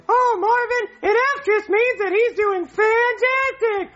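high slow cooing mom voice. Pretend. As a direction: dote on him like a silly mom. [name] voicing mom.

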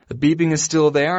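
ordinary delivery

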